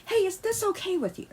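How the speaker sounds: Hey said high and sweet; mock sweet ask. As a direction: ask it sweetly, like a model partner. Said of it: "really ask them, like".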